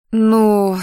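девичье тягучее «Ну», повыше и громче; протяни слово, не спеша продолжать; протяжно. A narrator's reading. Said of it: "протянула она". (протяжно, девичьим голоском)

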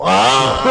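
high whoop into laughter; loud laughter. Real, not acted. Storyteller lands the Castro joke, bursting into high laughter. Cracking up at own punchline.

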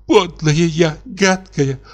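high, wailing self-reproach; sobbing. A narrator's reading. Katya on the sofa crying to Gruzdev, high sobbing lament. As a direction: sob out the words in despair. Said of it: "лежала на диване и рыдала".

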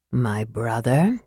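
slow, low, soft address; gentle. A narrator's reading. Raistlin to his twin after letting his breath out, gentle. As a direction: drop the voice low and speak gently. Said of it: "said Raistlin gently".

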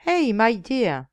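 slow, loud put-on English accent hailing the cab; a Frenchman faking a thick English accent; mock English accent. Reporter hails the coachman, faking a thick English accent. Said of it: "en imitant un fort accent anglais".

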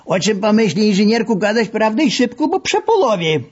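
put-on high, playful Lwów-accented threat; mock menacing masseur. A narrator's reading. Masseur playfully threatens the engineer on the couch. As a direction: threaten him like a jovial bully.

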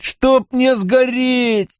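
high, long drawn-out wail of a curse; anguished oath. A narrator's reading. Mat repeats the curse in despair. (отчаянно, высоко и протяжно)

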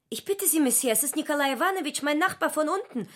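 high, rushed pleading; pleading maid. Acted. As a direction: beg quickly in a high voice. Natascha pleads for her neighbour.

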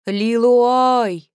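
a loud call across rocks. Acted. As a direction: call her name loudly. Calling out a name. (громкий оклик)

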